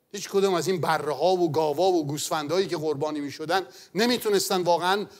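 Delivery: usual speaking voice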